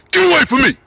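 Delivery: loud gruff brush-off; gruff shout. Retold or imitated. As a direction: shout him away. Uncle scolds the man.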